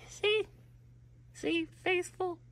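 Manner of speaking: tiny falsetto voice; comic falsetto doubt, lightly voiced; high squeaky questioning. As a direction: ask it in a soft, very high falsetto. Mock questioning of Wario's faithfulness.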